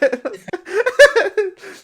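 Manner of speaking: loud high burst of laughter. Real, not acted. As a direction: break into a squealing laugh. Laughs at own bit.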